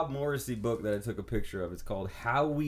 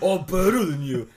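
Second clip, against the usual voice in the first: louder echo of a smug boast; mocking the quote; 4 semitones higher, 9 dB louder than usual. Repeating a friend's invented brag, mock boast. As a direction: scoff back the friend's fake line. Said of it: "None of that even sounds like me".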